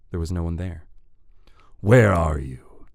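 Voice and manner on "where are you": loud, slow demand in southern-free villain voice; drawn-out, forceful call. A narrator's reading. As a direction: call into the distance for someone hidden. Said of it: "Ashton called out".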